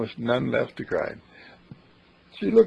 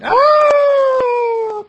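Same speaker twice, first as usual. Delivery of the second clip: falling scream; 10 dB louder than usual. Acted. Boyfriend falls off cliff.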